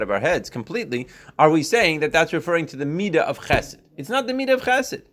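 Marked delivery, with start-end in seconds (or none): 4.0-5.0 emphatic high insistence. Real, not acted. Teacher insists on key point.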